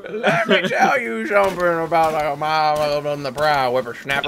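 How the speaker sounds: put-on laughing grumpy old man; cranky geezer scolding. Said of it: "That's what it sounds like".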